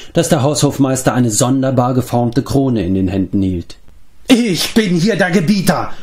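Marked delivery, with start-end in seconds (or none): 4.3-5.9 high, crazed bellow; roared claim. A narrator's reading. Mark asserting mastery to Taran, bellowing high. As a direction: bellow as the self-made master. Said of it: "brüllte Mark".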